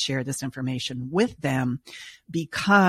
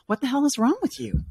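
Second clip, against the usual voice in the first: higher-pitched, hammed-up exasperation; mock exasperated scolding; 10 semitones higher than usual. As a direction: toss it off as the harsh judgment they expect. Imagined harsh therapist scolding.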